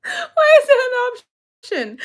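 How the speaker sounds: high, squeaky laughing question. Real, not acted. Vlogger baffled by a quiz option, laughing high. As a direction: laugh through disbelief.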